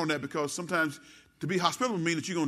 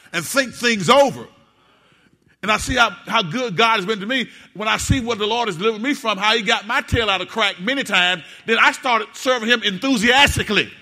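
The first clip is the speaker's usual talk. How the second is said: loud, raised, impassioned testimony; full-force preaching; 5 semitones higher, 10 dB louder than usual. Real, not acted. Preacher testifying what the Lord has done, full-force preaching. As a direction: preach it out, building to a loud peak.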